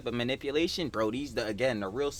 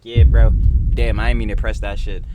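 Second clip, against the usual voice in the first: accidentally loud; 13 dB louder than usual. Real, not acted. Mic bump, loud.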